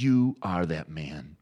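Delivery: slow, emphatic retold accusation; measured, weighty pronouncement; pointed accusation. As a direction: deliver it slowly, like a prophet confronting a king. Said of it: "he points to David and says".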